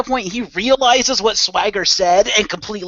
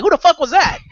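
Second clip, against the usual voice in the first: high, louder startled question; shocked exclamation; 11 semitones higher, 4 dB louder than usual. Retold or imitated. Seeing a huge figure onscreen, higher startled blurt. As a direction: blurt it out in disbelief. Narrator's shocked reaction retold.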